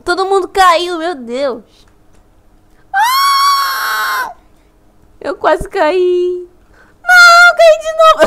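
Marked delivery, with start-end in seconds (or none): 2.9-4.3 sudden high scream; startled scream. Real, not acted. Girl screaming as she nearly falls in the game, high. 7.0-8.2 dismayed cry. Real, not acted. Falls again in game.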